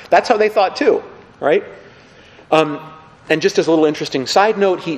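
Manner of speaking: ordinary delivery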